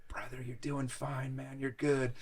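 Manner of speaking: soft, slow reassurance; comforting. Retold or imitated. Helper soothes the man puking in ayahuasca, comforting. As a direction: gently talk a struggling friend through it. Rashad comforting him while he vomits.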